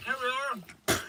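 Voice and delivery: high and soft, half to himself; soft high murmur. Real, not acted. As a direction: let it fall away quietly. Third take, very soft.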